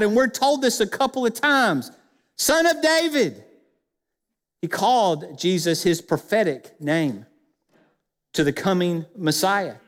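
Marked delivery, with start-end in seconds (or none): 2.4-3.4 high-pitched calling cry; yelled out. Acted. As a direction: holler it out to be heard. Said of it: "he yelled out".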